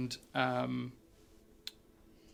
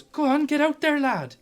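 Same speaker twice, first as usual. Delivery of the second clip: much higher, louder shove-off cheer; gnomish woman urging; 12 semitones higher, 6 dB louder than usual. Acted. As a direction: send him out into the ring. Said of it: "And she goes".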